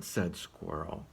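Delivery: plain reading voice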